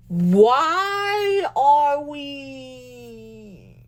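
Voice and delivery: hugely drawn-out, wailed question; exasperated plea. Real, not acted. Vlogger asking fans why they get upset, exasperated. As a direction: stretch each word in exasperation.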